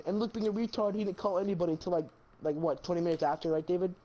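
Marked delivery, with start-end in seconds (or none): none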